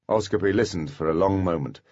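ordinary delivery

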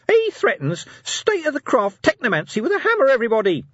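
very high, loud outraged squeal; shouting imp. Acted. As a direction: shout it to the whole street. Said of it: "it shouted".

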